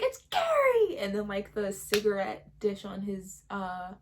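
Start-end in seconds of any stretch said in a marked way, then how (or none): 0.0-1.0 much higher, louder snapping correction; angry shout. Retold or imitated. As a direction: yell the name to finally correct him. Carrie finally snaps at principal.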